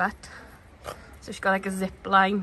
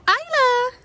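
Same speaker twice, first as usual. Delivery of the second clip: very high calling coo; 14 semitones higher, 4 dB louder than usual. Real, not acted. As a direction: call sweetly to a pet. Calling to Isla.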